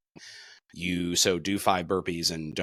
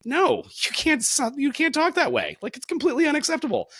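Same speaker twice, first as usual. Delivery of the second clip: emphatic high insistence; 3 dB louder than usual. Real, not acted. Emphatic stand against disrespect.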